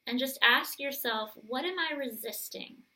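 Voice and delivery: soft, gentle guiding voice; calm guided meditation voice. Acted. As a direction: lead them inward, softly.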